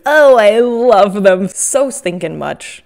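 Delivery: loud, slow, squealing adoration; gushing. Real, not acted. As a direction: swoon over the plush. Adoring the finished beluga.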